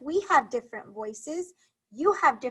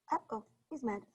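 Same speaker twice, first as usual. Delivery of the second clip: hushed storybook aside; 11 dB quieter, faster than usual. Acted. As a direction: drop to a worried hush.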